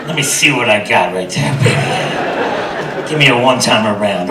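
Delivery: low, soft come-on; seductive husband. Acted. Husband admires wife fresh from the shower, low seductive voice. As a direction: sweet-talk her low and softly.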